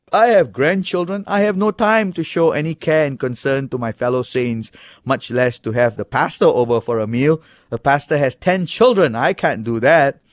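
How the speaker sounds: mock a busy mother making excuses; mocking complaint. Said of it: "Or do you say".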